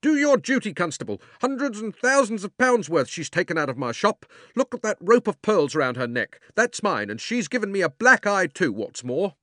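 high, loud outraged tirade; robbed shopkeeper shouting at the constable; angry shouting. A narrator's reading. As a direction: demand justice at the top of your voice. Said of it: "cried the fat man".

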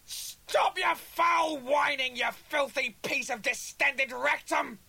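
high, furious, drawn-out roar; maximum-volume bellow. A narrator's reading. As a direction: bellow the insult with everything you have. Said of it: "bellowed at maximum volume".

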